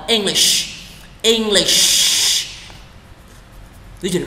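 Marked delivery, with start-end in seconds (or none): 0.1-2.1 English ending in a long hushing sh; pouted SH. Shown as a demonstration. Teacher tells learners to pout, hushing sh. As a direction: push the lips out for the sh ending of English. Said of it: "Chu cái mỏ của bạn ta".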